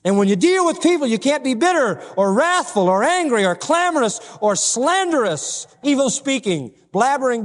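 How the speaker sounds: high, ringing sermon crescendo; impassioned preaching. Real, not acted. Preacher assuring believers of salvation, impassioned. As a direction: press each assurance home, pitched high.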